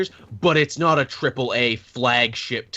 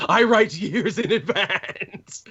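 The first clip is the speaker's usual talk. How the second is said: slow, higher mock-villain boast; Ozymandias; 6 semitones higher, slower than usual. Writer boasting of working ahead, hammed-up gloating. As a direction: draw it out as a gloating mastermind. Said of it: "Was he Ozymandias?".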